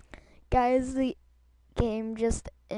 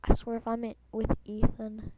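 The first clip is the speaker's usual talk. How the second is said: ordinary talk, nothing marked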